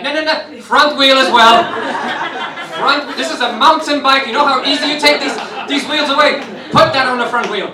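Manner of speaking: bleeding but shouting orders about a bike; frantic insistence. Retold or imitated.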